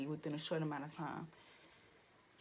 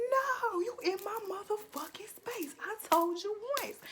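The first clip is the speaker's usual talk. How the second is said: very high, loud strained yell; retold angry outburst; 14 semitones higher, 6 dB louder than usual. Speaker retells snapping over her space, high-pitched fury. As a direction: shriek it back as you did in the argument. Acts out losing her temper.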